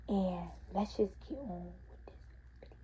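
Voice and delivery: hushed and trailing; whispered sign-off. Real, not acted. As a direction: whisper it under your breath. Muttering plug before the prank.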